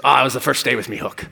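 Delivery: a light pirate voice, quickened; pirate accent. Retold or imitated. The pirate delivering the punchline, in a quick light pirate accent. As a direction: rattle off the punchline like an old sea dog. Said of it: "My pirate accent's really good".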